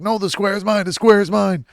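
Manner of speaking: high, loud mock-crazed yell; hammed-up madman claiming the square; homeless man's rant. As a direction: bellow in a shrieking high voice. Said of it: "He's like".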